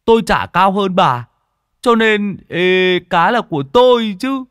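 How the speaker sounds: loud mocking lad; cocky Tý taunt. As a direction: taunt her to outbid him. Tý taunts the fat woman.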